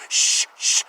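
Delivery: unvoiced shush. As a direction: hush them like a fussy shopper. Shushing people in Ashland.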